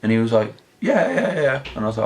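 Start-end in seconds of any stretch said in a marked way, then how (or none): 0.8-1.6 a young boy's quick, much higher yeahs, mildly imitated; eager kid. Nine-year-old boy answering the narrator about Charmander, higher and eager. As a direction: lightly mimic a keen nine-year-old.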